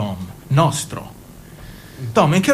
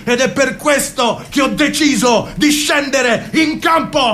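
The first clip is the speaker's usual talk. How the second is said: political rally speech; 5 semitones higher than usual. Acted. Jimmy turns a private talk into a campaign speech, building to a proclamation. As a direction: build to a stirring public address.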